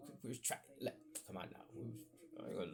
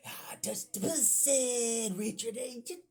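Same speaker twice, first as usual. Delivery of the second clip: high, loud, drawn-out idiot voice; acting like idiots; 11 semitones higher, 8 dB louder, much slower than usual. Boys teasing the teacher's daughter, acting like idiots. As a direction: blare it out like goofing kids. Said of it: "trying to be like idiots".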